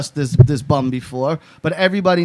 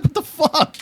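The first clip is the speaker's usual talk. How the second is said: high-pitched mock shock, half laughing; incredulous reaction; 12 semitones higher, slower than usual. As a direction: retell your disbelief way up high. Retold shock at friend.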